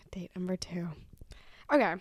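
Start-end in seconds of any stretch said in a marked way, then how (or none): 0.1-0.9 unvoiced dramatic whisper. Real, not acted. Announcing date number two to viewers in a whisper. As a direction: whisper the heading like a secret. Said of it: "whispered heading".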